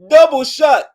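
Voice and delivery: high, loud hype shout; excited shout. Real, not acted. Reacting to a party clip, excited shout.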